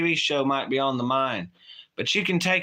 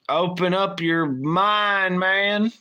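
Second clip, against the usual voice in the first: mock guru; 5 semitones higher, much slower than usual.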